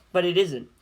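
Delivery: plain reading voice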